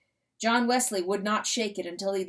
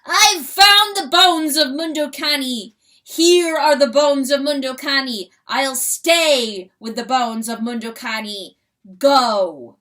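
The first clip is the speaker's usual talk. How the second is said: loud, wailing lament; lamenting crow; 4 semitones higher, 10 dB louder than usual. Acted. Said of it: "Like a lamentation, the rooster crowed".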